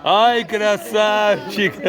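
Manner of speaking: high, loud, slow delighted cheer; excited cheer. Real, not acted. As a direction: shout praise at the bear. Bear does a trick, cheering.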